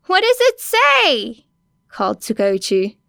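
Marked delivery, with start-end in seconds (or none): 0.1-1.5 higher, louder and slow call; detective calling up to the officer. A narrator's reading. Detective calls up.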